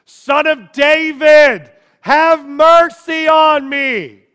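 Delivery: desperate full-voiced scream, high and slow. Blind beggar screaming to a passing crowd for mercy. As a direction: cry out with everything, slowly. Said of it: "He starts screaming".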